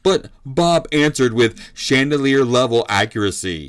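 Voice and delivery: loud, emphatic round recap; fight commentator. Acted. Commentator summing up round one for Bob, energetic play-by-play.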